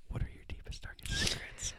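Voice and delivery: fully whispered, no voice; hushed spooky whisper. Acted. Repeated after shh.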